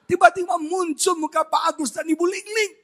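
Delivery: high, excited retelling of the caller; excited announcement. Retold or imitated. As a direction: announce it like a thrilling revelation. Repeats caller's line, heightened. (mengumumkan dengan heboh, nada tinggi)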